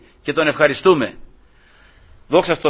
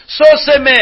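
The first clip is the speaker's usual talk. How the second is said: crying out; 8 semitones higher, 4 dB louder, slower than usual. Retold or imitated. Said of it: "Αλλά φώναξα".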